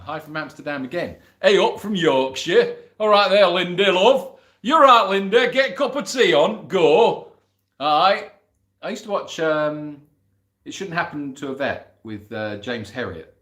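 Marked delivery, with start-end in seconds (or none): 1.4-8.3 loud, high mock Yorkshire greeting; hearty put-on Yorkshire, louder and higher; Yorkshire accent. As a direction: belt it out in broad playful Yorkshire.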